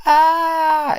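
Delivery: high, loud gleeful shriek; gremlin shriek. Retold or imitated. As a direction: let out a piercing cackling yell. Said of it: "they go".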